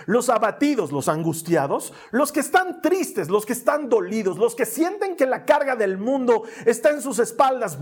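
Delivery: high, loud, driving sermon delivery; forceful preaching. Acted. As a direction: preach it with conviction to the congregation. Building list of the burdened. (predicación enérgica y apasionada)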